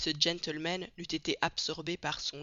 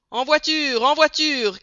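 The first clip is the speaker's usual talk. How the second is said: crié fort et haut; le conducteur appelle les voyageurs; 9 semitones higher, 11 dB louder, slower than usual. A narrator's reading. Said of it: "criait le conducteur". (appel du conducteur à pleine voix)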